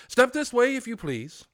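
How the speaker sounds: high, loud pretend order; mock-stern command. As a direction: bark it like a stern master, in jest.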